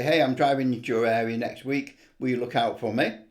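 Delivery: usual speaking voice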